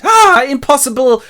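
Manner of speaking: high, loud mock gasp of disbelief; shocked cry. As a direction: cry out aghast, hammed-up. Harker sees the Count.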